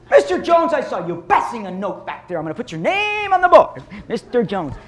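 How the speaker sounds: scolding teacher. Pretend. Principal scolding a teacher at faculty meeting, mock loud scold. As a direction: call her out like a petty schoolmarm.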